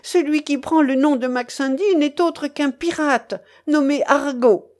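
high, ringing denunciation; exclaimed accusation. A narrator's reading. Young woman exposes the pirate to the guests, crying out. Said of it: "s'écria Mélanie".

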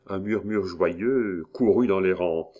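ordinary delivery

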